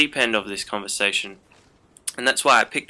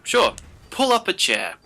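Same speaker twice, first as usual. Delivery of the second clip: retold agreeable reply; 8 semitones higher than usual. Retold reluctant agreement to visitors.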